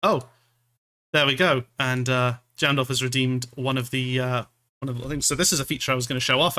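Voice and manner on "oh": ordinary talk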